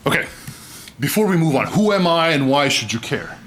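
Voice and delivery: ordinary talk, nothing marked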